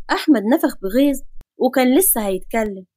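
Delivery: usual speaking voice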